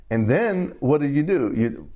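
normal talking voice

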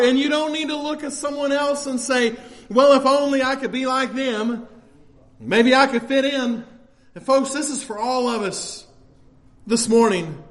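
usual speaking voice

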